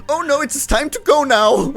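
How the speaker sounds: loud, high mock-panicked bear; panicky bear character. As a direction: cry out in a high put-on voice. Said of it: "your friend N goes".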